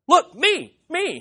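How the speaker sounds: excited, high-pitched bid to be noticed; jumping up and down. Acted. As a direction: pipe up eagerly, as if bouncing. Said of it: "like, jumping up and down".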